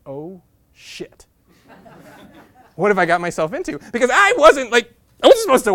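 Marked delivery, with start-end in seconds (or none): 0.0-1.2 a touch quiet dread. Retold or imitated. Boy seeing his name on the list, mild quiet shock. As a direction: breathe out the realization softly. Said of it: "that single thought is".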